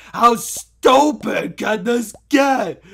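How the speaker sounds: slow, exasperated yell; frustrated shout. Real, not acted. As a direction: yell it out in disbelief. Frustrated at losing jetpack.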